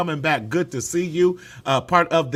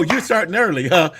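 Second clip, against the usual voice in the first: ordinary delivery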